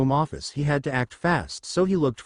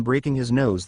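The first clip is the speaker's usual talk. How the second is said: plain reading voice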